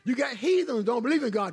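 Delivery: ordinary talk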